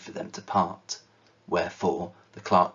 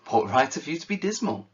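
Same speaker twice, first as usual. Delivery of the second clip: higher, bright, teasing comeback; gaily; 7 semitones higher, 4 dB louder than usual. Acted. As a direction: throw his words back at him cheerfully. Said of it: "returned his nephew gaily".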